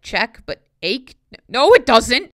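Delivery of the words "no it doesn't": loud retort. Real, not acted. Contradicting the poem.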